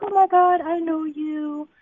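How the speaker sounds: high, drawn-out excited recognition; Tyra Banks. Retold or imitated. Idol spotting the model, high acted delight. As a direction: imitate a star gushing, stretching the last words.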